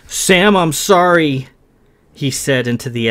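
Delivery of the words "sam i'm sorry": raised, slow plea into the dark; pleading young man. Acted. Kay calls to Sam in the dark, pleading. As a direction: call out an apology into an empty chapel.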